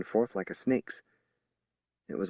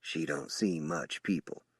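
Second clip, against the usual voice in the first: much softer, lower aside; whispered aside; 4 semitones lower, 8 dB quieter than usual. A narrator's reading. Sam whispering to Julie about the woman, soft. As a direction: murmur it to her privately. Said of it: "then whispered to me".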